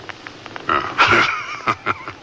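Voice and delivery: laugh. Acted. Host amused at guest's shock.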